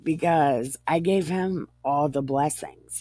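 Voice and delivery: ordinary talk, nothing marked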